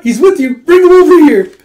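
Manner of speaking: loud high gossipy shriek; excited shriek. Retold or imitated. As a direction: squeal for him to be brought over. Friend eager to meet the crush.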